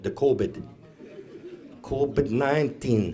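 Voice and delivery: covid said as "KOH-bid"; elders' pronunciation. Retold or imitated. Comic telling how elders name the virus, covid pronounced "KOH-bid". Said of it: "that's what our elders call it".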